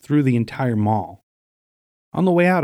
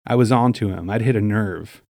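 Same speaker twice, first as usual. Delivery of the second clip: usual speaking voice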